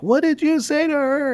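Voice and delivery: hammed-up high, irate screech; imitated angry yelling. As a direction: mimic a crazy lady confronting you. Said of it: "is about yelling at me".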